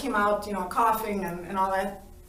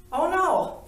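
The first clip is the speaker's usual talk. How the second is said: high, louder protest; arguing back; 8 semitones higher, 4 dB louder than usual. Retold or imitated. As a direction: object loudly, playing up your own refusal. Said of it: "I kept arguing".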